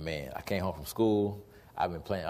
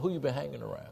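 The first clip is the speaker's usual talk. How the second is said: softer, moderately raised question; admiring question; 5 semitones higher, 5 dB quieter, faster than usual. Acted. Acted friend noticing a change for the better, softer admiring question. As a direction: ask it as a compliment. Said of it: "let's put it on the good side".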